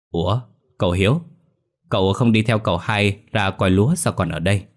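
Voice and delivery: plain reading voice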